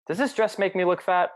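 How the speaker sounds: asking partner. Acted. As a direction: ask it like a partner fishing for reassurance.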